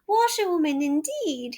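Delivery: reckless shout. A narrator's reading. Toad shouting at the passengers, reckless. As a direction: throw off the disguise angrily. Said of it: "he shouted recklessly".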